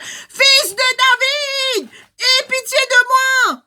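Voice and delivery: very high, slow, loud desperate plea. Acted. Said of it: "il crie plus fort encore".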